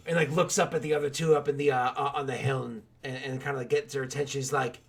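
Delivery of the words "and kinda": normal talking voice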